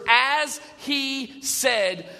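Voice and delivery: as he said drawn out very slowly; slow emphasis on as he said. Shown as a demonstration. Pastor savoring the angel's three words, very slow. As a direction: lay each word down slowly, as he said. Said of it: "those next three words".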